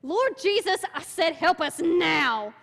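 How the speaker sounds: imitating a shouted, angry prayer. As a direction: order Jesus to help, furious. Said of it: "she gets mad 'cause he's still choking".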